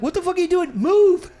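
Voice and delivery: retold outburst at a stubborn game; exasperated yelling at game. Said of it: "I'm talking to the thing".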